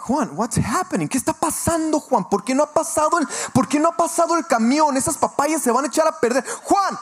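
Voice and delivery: high, loud urgent demand, switching into rapid Spanish; frantic yelling. Retold or imitated. Broker demands answers over stuck papayas, frantic shouting. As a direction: act out hollering at Juan down the phone.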